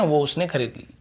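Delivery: ordinary talk, nothing marked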